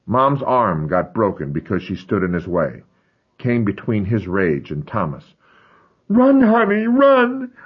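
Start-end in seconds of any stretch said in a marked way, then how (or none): 6.1-7.5 high, frantic shriek to flee. Acted. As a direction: scream at him to run. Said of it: "I remembered her shrieking".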